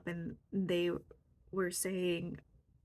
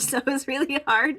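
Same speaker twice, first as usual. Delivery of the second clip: laughing; 7 semitones higher, 12 dB louder than usual. Real, not acted. Laughing while recalling tears.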